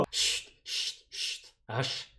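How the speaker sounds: « chut » sans voix, répété; chut soufflé. Real, not acted. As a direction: faire taire d'un souffle. Fait taire quelqu'un en plaisantant. (chut chuchoté)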